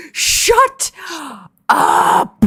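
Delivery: high, drawn-out exasperated yell; re-enacted crisis-state scream at a friend; yelled outburst. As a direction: yell it in frustration, stretching each word. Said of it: "I literally fucking yelled at him".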